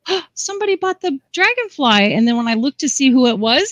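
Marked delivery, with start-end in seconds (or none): 0.1-2.1 higher-pitched, re-enacted excited discovery; surprised delight. Artist retells spotting an online sale, surprised delight. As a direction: act out finding out about a sale, pitched up.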